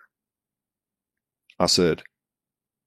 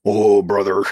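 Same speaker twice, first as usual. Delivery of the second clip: hammed-up Hulkamania shout; Hulk Hogan voice; 3 semitones higher, 5 dB louder, slower than usual. Shown as a demonstration. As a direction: growl it like a pro wrestler. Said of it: "I can't do the Hulkamania voice".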